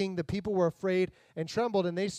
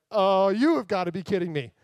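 disbelieving groan; 6 dB louder than usual. Pretend. Peter's reaction to being called.

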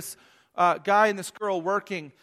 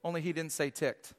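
normal talking voice

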